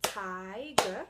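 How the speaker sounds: tiger said loud, set apart; loud teacher emphasis on tiger; stressed example word. Shown as a demonstration. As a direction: lean on tiger for the class. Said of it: "So we have".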